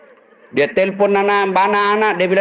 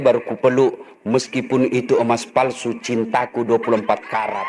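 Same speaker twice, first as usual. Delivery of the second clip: mock-romantic line; 9 semitones lower, 3 dB quieter than usual.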